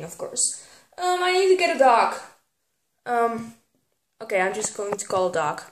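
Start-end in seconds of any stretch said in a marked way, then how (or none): 0.0-0.7 whispered aside. Real, not acted. Aside while guessing flavour.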